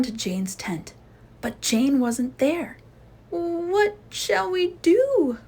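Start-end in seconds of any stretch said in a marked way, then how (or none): 3.3-5.4 high, slow panicked cry; frightened cry. Acted. Sister Bear crying out to the Scouts in terror. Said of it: "cried Sister Bear".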